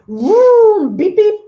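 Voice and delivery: vroom and beep beep, loud and high like a flashy car; car sound effects. Acted. Narrator imitating the car arriving, loud and playful. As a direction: honk it out like a toy car.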